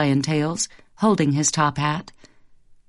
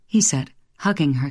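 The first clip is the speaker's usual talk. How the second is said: ordinary delivery, nothing marked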